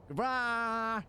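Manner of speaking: high cartoon goodbye; Meta's voice. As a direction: chirp it like a cartoon. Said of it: "at the very end go".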